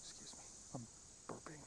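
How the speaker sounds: soft unvoiced whisper. Real, not acted. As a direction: whisper an apology. Whispered apology for burping.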